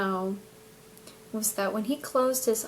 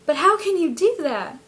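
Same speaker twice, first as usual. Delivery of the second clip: amazed; 6 semitones higher, 5 dB louder than usual. Retold or imitated. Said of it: "And I went".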